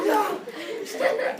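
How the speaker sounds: high, loud comic no; mock shouted refusal. Prometheus slapping the foolish humans, mock shouted refusal. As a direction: cry out to stop them.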